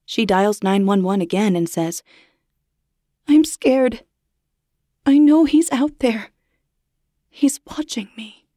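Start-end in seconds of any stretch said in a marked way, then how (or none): 3.3-6.3 higher, louder frightened plea; scared plea. Acted. Babysitter calls nine one one. 7.3-8.5 hushed, afraid to be heard; terrified whisper. Acted. Babysitter tells dispatcher, terrified.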